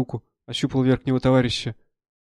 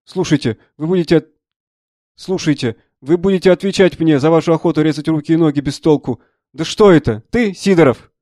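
raised, forceful reprimand turning to startled recognition; angry colonel; 3 semitones higher, 7 dB louder than usual. Acted. Colonel rebuking the doctor, then spotting Sidorov, loudly indignant. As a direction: take the doctor to task loudly.

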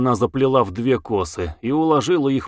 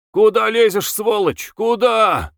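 high, raised-voice scolding shout; angry cursing; 9 semitones higher, 3 dB louder than usual. A narrator's reading. Peasant to the driver who snagged his cart, cursing. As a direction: swear at the driver who hit you. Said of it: "начал ругаться".

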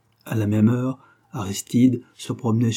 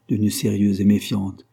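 ordinary delivery

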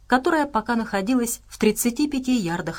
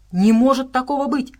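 loud, higher bark of disbelief; raised, indignant exclamation; barked outburst; 6 semitones higher, 5 dB louder than usual. A narrator's reading. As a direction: bark it out in protest. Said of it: "гаркнул медвежонок".

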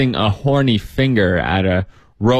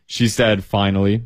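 ordinary delivery

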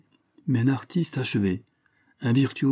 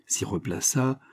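plain reading voice

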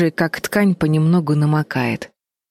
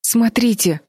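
higher-pitched startled exclamation; mild gasp; 6 semitones higher, slower than usual. A narrator's reading. Fliss points the others to the bed, mildly gasping. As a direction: exclaim with restrained surprise. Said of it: "ахнула Флис".

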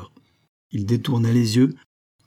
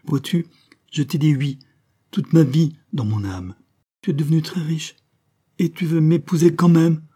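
higher, louder joyful outpouring; overcome with joy; 8 semitones higher, 4 dB louder than usual. A narrator's reading. Marianne pours out her joy to Prosper, higher and louder. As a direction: let the happiness spill over.

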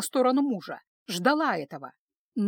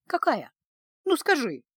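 lightly mocking, pitched up; scoffing challenge; 4 semitones higher than usual. A narrator's reading. Daughter challenging her mother, lightly scoffing. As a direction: dare her with a small scoff. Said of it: "усмехнулась Аня".

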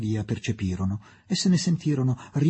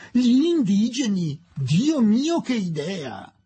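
higher, louder mock horror, slowed; shocked exclamation; 10 semitones higher, 4 dB louder, slower than usual. A lady mocks Adela's wish, higher, louder mock horror. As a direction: gasp at the scandal of it.

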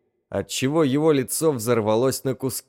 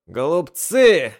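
loud, slow, high yell; shouted; 5 semitones higher, 6 dB louder, slower than usual. Acted. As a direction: bellow it out. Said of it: "крикнул священник". (громкий крик)

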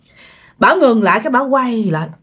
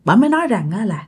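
usual speaking voice

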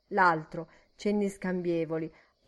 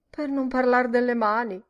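complaining; 5 semitones higher, 6 dB louder than usual. Acted. Barmaid complaining in dialogue.

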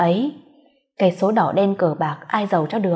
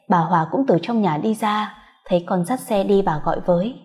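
usual speaking voice

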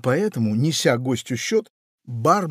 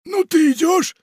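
very high, louder call; pirate calling; 6 dB louder than usual. Acted. Pirate calls the parrot, very high and loud.

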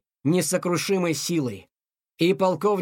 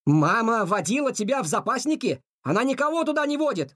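high, incredulous questioning; surprised; 6 semitones higher than usual. A narrator's reading. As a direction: react in disbelief, pitch climbing. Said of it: "удивился Тихон". (удивлённо, высоким голосом)

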